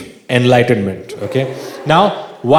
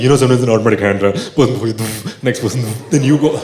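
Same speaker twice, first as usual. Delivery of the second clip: fast, giggling through the words; rushing the story while cracking up; laughing explanation; 8 semitones lower, faster than usual. Retold or imitated. Hurried giggling story to his friend in pain. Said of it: "I was like".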